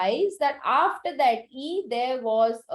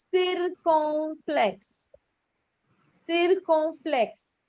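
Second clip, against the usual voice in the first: circumflex drawn out slowly; slow teaching delivery; 3 semitones higher, much slower than usual. Shown as a demonstration. Teacher introducing a new term to class, slow and deliberate. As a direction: spell out the term by ear, very slowly.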